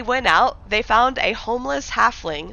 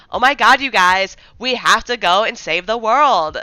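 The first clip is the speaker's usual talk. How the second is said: loud, hammed-up Valley Girl; Valley Girl adventurer rallying the party, stretching the last words; Valley Girl accent; 8 dB louder than usual. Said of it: "the only one I can do is, like, a Valley Girl".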